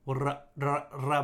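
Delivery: 「わら、ら、ら」 trilling the ra; rolled r. Shown as a demonstration. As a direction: roll the r in each 「ら」. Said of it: "みたいなね". (「ら」を巻き舌で)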